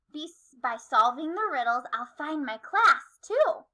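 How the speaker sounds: usual speaking voice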